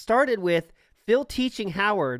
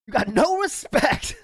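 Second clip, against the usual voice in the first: high-pitched mock outrage; hammed-up indignant outburst, voice leaping up high; Howard; 8 semitones higher, 4 dB louder than usual. He mimics the blunter friend berating people, mock outrage.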